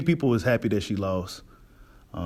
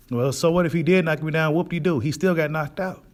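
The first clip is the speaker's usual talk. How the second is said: higher-pitched dismissive retort, lightly imitated; mock a shrugging so-what comeback; lightly acted brush-off, pitched up; Terrence Crawford; 6 semitones higher than usual. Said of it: "Crawford responds to this and says".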